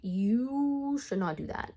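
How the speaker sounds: slow warning with a lengthened "you"; drawn-out scolding. Retold or imitated. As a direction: stretch "you" long and slow. Retold scolding, drawn-out first take.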